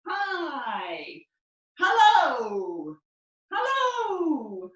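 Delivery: loud, bright calls of hello; friendly loud hellos; greeting passers-by. Shown as a demonstration. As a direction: call hello across the street. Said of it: "So we're gonna say".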